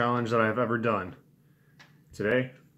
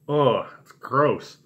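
disgusted, mouth burning from mint; slow groaning disgust; strong mint; 3 semitones higher, 4 dB louder, slower than usual. Real, not acted. Reacting to the mint taste.

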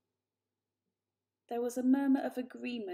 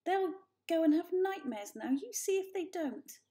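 higher, fussing mother; fretting mother; 8 semitones higher, 3 dB louder than usual. A narrator's reading. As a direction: fret over the kittens. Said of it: "tutted Mother".

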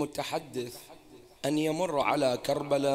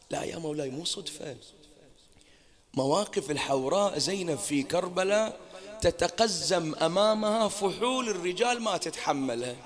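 ordinary talk, nothing marked

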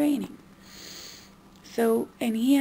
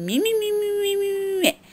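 mocking me me me; 12 semitones higher, 6 dB louder than usual. Mocking mom's self-centredness.

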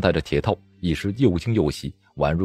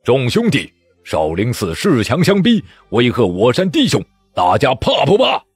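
loud, raised call to the troops; a leader shouting to his men before battle; rallying shout; 4 semitones higher, 6 dB louder than usual. Acted. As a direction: rouse the whole army. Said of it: "口中高呼道".

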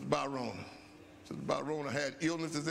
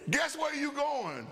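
teasing boss; 9 semitones higher, slower than usual. Retold or imitated. Boss voiced in story.

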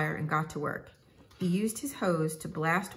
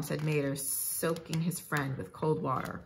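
plain reading voice

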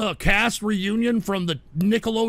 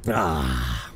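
low, breathed-out sigh after a swig; satisfied drinker's "ah". Real, not acted. Host swigging scotch from the bottle, satisfied "ah". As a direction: exhale after a long gulp.

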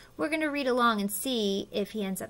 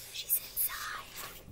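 slow, hushed whisper; 16 dB quieter, much slower than usual. Pretend. Reader tells child where the caterpillar hid, whispering. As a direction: share a secret under your breath.

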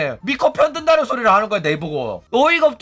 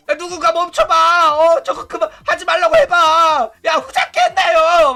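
high, loud mock shouting; panicked pleading; 7 semitones higher, 5 dB louder than usual. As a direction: imitate frantic cries for someone to stop it. Acting out panicked pleas to stop.

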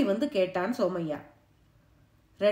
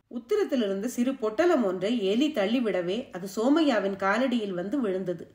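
ordinary delivery

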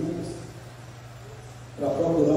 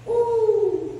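distant answering call; 3 dB louder than usual. Retold or imitated.

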